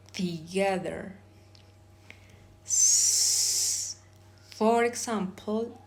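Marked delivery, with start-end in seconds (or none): 2.7-4.0 S as a long, sustained hiss; isolated consonant sound. Shown as a demonstration. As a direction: hiss out S alone, no vowel. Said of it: "I am going to pronounce".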